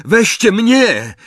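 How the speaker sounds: high, loud, drawn-out plea; desperate shout. A narrator's reading. Ron begs to take Hermione's place, shouting. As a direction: cry out, offering yourself. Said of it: "krzyknął Ron".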